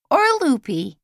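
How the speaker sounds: put-on high and loud, a boy's eager reassurance; young boy Carl. A narrator's reading. Boy assuring Lizzie she isn't batty, loud and high.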